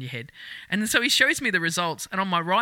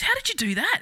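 surprised question; 12 semitones higher than usual. Retold or imitated. Doctor quoted, high surprised.

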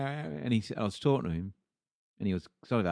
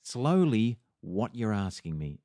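slow, firm, spelling it out; slow stern demand to the kid; slow, deliberate demand; 5 dB louder, slower than usual. Retold or imitated. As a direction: lay each word down slowly. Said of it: "I went".

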